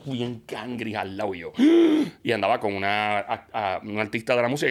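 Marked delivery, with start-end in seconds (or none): 1.6-2.2 jadeo fuerte y agudo de sorpresa; jadeo de asombro. Retold or imitated. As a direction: aspira de golpe, impresionado. Ve al papá de [name]. (jadeo al ver entrar a [name])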